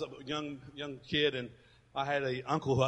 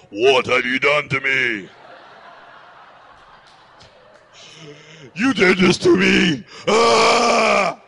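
loud, strange, accusing voice of a woman in labour; possessed labour voice; 3 semitones higher, 14 dB louder, much slower than usual. Retold or imitated. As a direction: howl the blame at him, slow and loud. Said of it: "this voice comes out of her".

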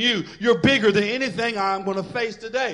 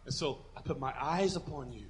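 quiet, softer prayer; sincere prayer; 5 semitones lower, 12 dB quieter than usual. Shown as a demonstration. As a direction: tell God gently and sincerely. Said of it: "But what I do, this is".

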